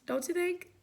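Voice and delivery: ordinary delivery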